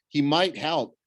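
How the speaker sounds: usual speaking voice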